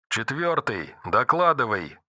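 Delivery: quiet tinny radio bark. Acted. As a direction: bark it through a radio speaker. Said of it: "рявкнула рация".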